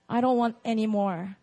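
ordinary delivery

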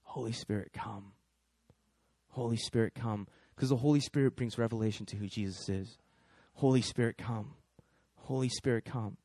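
hushed inward prayer; soft prayer. Retold or imitated. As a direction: murmur a quiet plea, falling to almost nothing. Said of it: "she just begins to pray".

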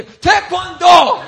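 high, slow mocking cry; mock shout. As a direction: scoff at the martial art. Mocking Taekwondo.

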